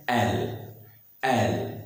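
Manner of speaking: एल closing on a held l; एल with no vowel released after l; tongue held on L. Shown as a demonstration. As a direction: keep the tongue in place at the end of एल. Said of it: "जीभ हटनी नहीं चाहिए".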